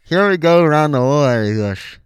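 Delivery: talking with a mouthguard in; words blurred by a mouthguard. Real, not acted. He got the mouthpiece in already.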